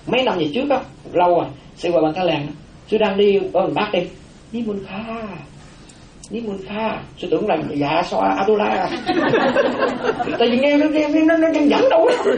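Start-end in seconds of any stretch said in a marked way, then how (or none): 4.5-7.0 hushed, gentle imitation of a woman's call; small soft voice. Monk retelling a woman's call at dawn, small soft voice. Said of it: "Cái giọng nó nhỏ nhẹ như vậy đó". 8.9-10.3 high-pitched burst of laughter. Real, not acted. Monk amused by his own mistaken fright, laughing. 10.4-12.3 high, laughing speech. Real, not acted. Monk explaining his silly fright to listeners, laughing as he talks.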